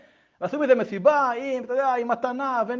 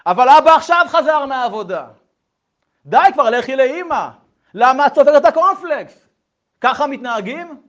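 very loud, raised, impatient; parental scolding shout; 5 semitones higher, 16 dB louder than usual. Retold or imitated. Parent yells at the hard-of-hearing girl, scolding shout. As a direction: imitate a parent yelling at a child. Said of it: "רק שצועקים עליה".

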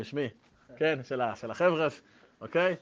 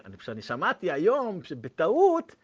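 ordinary talk, nothing marked

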